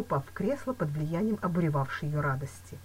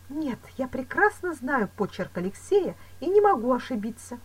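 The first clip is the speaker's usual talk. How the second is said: lightly voiced woman, higher-pitched; anxious Louise; 9 semitones higher, 5 dB louder than usual. A narrator's reading. Woman insisting the handwriting is genuine, anxious. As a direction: insist with mild agitation.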